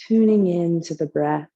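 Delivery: unhurried, calm yoga teacher leading relaxation; guided meditation. Real, not acted. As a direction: guide them gently into the breath, phrase by phrase. Leads a guided breathing meditation.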